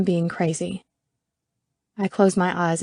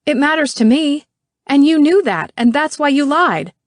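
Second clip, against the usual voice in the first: higher, louder, furious accusation; angry; 8 semitones higher, 7 dB louder than usual. Acted. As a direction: hurl it at him, pitch up. Angry confrontation in car.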